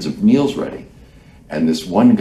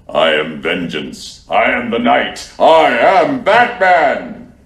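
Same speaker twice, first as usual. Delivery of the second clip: Batman voice; 3 semitones higher, 5 dB louder, much slower than usual. Pretend. Voice actor proving himself to the diners, Batman voice building. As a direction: growl the lines, then build to a triumphant slow peak. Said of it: "and I do from the back kitchen".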